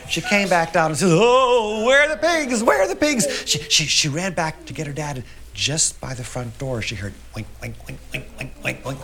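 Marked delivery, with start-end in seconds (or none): 1.1-3.3 high, loud wail of dismay; alarmed cry. A narrator's reading. Knocked-over girl looks for escaped pigs, alarmed cry. 7.3-9.0 quick little oinks, hushed; soft pig oinks. A narrator's reading. Girl hears pigs by the front door, soft oinking.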